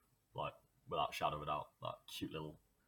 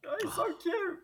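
cutesy squeal; 3 dB louder than usual. Real, not acted. Gushing over cute piggy.

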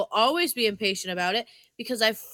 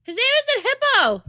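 much higher, louder and fast protest; indignant outburst; 9 dB louder, much faster than usual. Real, not acted. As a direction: fire back in outraged correction. Indignant over dog's name.

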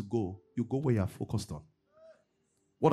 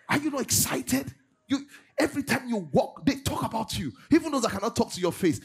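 high-pitched, urgent exhortation; excited preaching; 8 semitones higher than usual. Acted. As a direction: press the crowd to get excited.

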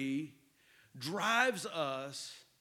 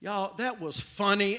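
ordinary delivery

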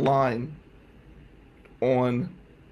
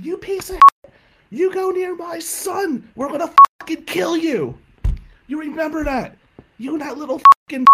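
high-pitched furious yell; screaming mom; 13 semitones higher, 9 dB louder than usual. Retold or imitated. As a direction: imitate a mother screeching threats to protect her son. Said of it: "my mom's screaming".

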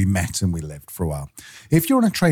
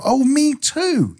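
high, drawn-out delighted reply; playfully imitated surprise; mock delight; much slower than usual. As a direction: stretch it out, much higher, like meeting a fellow countryman. Acting out a delighted traveller.

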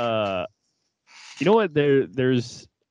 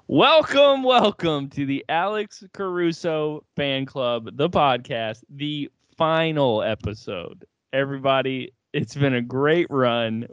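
grand announcer intro; 3 semitones higher, slower than usual. Acted. Countdown, then grand show opening.

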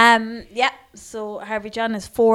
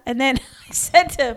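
ordinary talk, nothing marked

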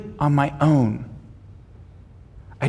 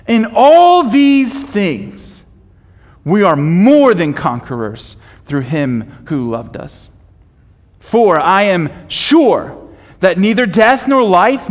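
scripture reading; 4 dB louder than usual. Acted. Pastor reading Romans eight to the church, building to a proclamation. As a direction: read with rising fervour.

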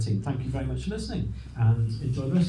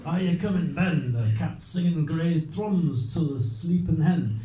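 louder, slow Scots verse; poem reading in Scots; 2 semitones higher, 4 dB louder than usual. Acted. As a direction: call out the rhyme in Scots.

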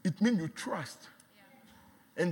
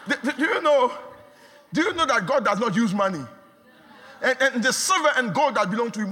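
raised, driving sermon voice with laughter; preaching at full force; 5 semitones higher, 5 dB louder than usual. Acted. Preacher urging the congregation about God's wealth, full force. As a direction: press the point home to the church.

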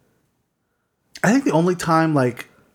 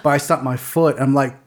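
ordinary delivery

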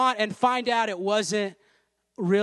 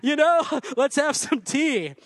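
mock British accent; 6 semitones higher than usual. Joking that the song characters aren't British, mock British accent. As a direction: ham up a posh British accent. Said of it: "British, like".

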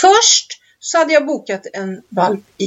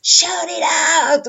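mock DJ cry, pitched up high; DJ shouting cue; 7 semitones higher than usual. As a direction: call it out like the dance leader. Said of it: "Så att man bara".

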